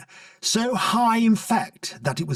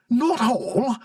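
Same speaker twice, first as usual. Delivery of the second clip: cried out; 8 semitones higher than usual. A narrator's reading. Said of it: "cried the Prime Minister".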